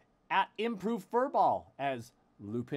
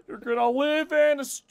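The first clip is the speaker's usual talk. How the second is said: much louder, higher, shouting bluster; Farley impression; 12 semitones higher, 17 dB louder than usual. Mocking the letter writer for the group, in a loud shouted Farley rant. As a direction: yell the warning like a manic life coach. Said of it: "Doing my best Foley impression".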